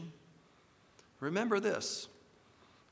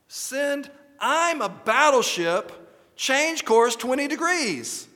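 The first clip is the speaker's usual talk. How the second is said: a much higher, louder, slow furious order; furious captain; 10 semitones higher, 8 dB louder, slower than usual. A narrator's reading. Captain bellows his order at the lighthouse, furious. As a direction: spit the order out in rage. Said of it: "the captain was furious. He spat out".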